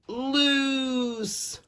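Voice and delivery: long high stretched word; drawn-out loose. A narrator's reading. Narrator to children, stretching the word high.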